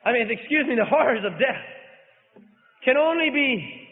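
fervent sermon delivery; preaching. Acted. Preacher opening at full force.

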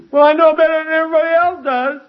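very high, loud caricature voice; mock know-it-all. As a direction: squeal it out as a conceited objector. Mocks a know-it-all objector.

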